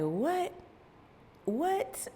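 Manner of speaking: mock-stunned, softly squeaked; retold disbelief. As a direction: act taken aback, pitch jumping up the second time. Acting her stunned reaction to daughter.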